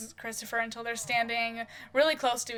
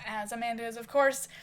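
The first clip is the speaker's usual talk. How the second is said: ordinary talk, nothing marked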